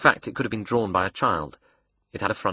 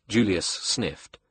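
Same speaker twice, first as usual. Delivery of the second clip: ordinary delivery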